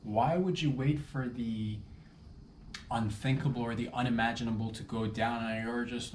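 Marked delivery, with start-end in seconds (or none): none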